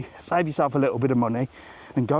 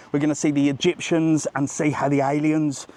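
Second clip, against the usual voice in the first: ordinary talk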